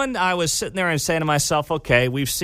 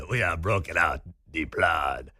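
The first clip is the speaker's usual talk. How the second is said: low, softer put-on French accent; mock French accent; 9 semitones lower, 5 dB quieter than usual. Host mocking Harris in France, low mock French accent. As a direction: drop low into a lightly faked French accent. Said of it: "by far the French accent".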